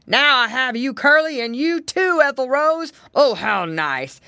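triumphant bear voice; gloating bear. A narrator's reading. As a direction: gloat over your catch, big and loud. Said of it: "the bear cried".

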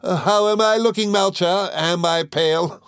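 ape a vain lady asking about her looks; mimicking a vain lady. Mowcher mocks her painted clients, high and affected. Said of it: "they'll say to me".

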